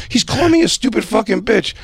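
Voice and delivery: high-pitched mock outrage; imitated outraged complainer. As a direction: mimic an officer whining to a colleague.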